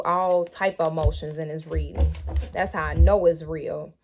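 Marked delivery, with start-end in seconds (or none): none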